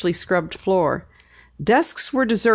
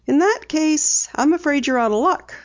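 teasing; 9 semitones higher than usual. A narrator's reading. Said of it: "Penny said teasingly".

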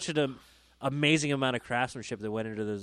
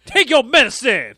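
higher, louder bossy parent order; mock stern parent; 10 semitones higher, 13 dB louder than usual. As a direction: bark it like a strict nanny.

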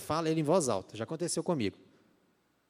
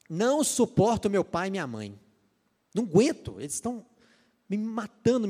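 put-on loud, high exasperated teenager rant; mock a fed-up teen complaining about parents; rebellious teen; 6 semitones higher, 4 dB louder than usual. Preacher imitating a fed-up teen complaining about parents, high loud rant. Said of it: "Tem gente que é assim".

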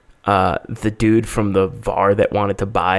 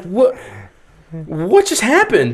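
high, drawn-out retold disbelief; dumbfounded; 6 semitones higher, much slower than usual. Hacker reacting to the freely given password, dumbfounded. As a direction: act out being stunned, stretching the words. Retelling own disbelief at the event.

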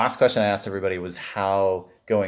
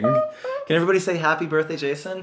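singsong puppet show host; 5 semitones higher than usual. Real, not acted. Cueing group birthday chant.

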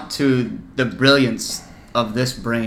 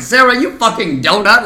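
higher, mock-outraged Ramsay jab; mock Ramsay insult; 6 semitones higher, 5 dB louder than usual. Host teases Sarah with the Ramsay line, playful mock insult. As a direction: throw the insult at Sarah Ramsay-style, in jest. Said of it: "my brain went".

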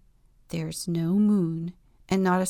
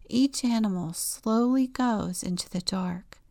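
plain reading voice

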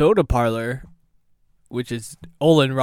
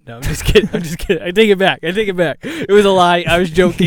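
raised, louder backpedalling; hurried retraction; 5 semitones higher, 6 dB louder, faster than usual. Pretend. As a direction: take it all back in mock panic.

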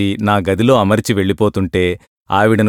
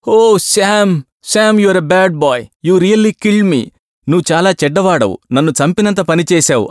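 put-on old landlady scolding; 8 semitones higher than usual. A narrator's reading. Calmed old landlady scolding lodger.

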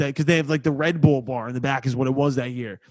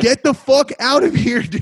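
higher, louder, shoving him off; mock bouncer yell; 8 semitones higher, 5 dB louder than usual. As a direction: bark it like a fed-up bartender. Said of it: "They're like".

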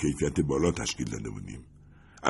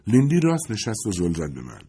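plain reading voice

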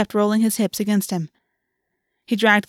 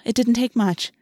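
ordinary delivery

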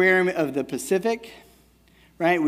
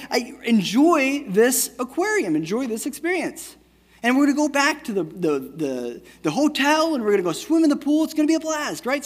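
higher, bright lecturing dad voice; 7 semitones higher than usual. Retold or imitated. Father mock-lecturing his crying daughter in the aquarium, hammed-up dad voice. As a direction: preach it like a know-it-all dad. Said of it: "typical dad, boring lesson stuff".

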